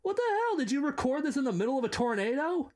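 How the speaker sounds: very high, fast disbelief; shocked. Real, not acted. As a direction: shriek in outrage at the noise. Loud wind noise in recording.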